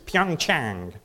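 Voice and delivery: Pyeongchang with a light Korean accent, crisp aspirated p; South Korean accent. Shown as a demonstration. Showing the Korean accent used with the children. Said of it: "that's my S-, South Korea accent".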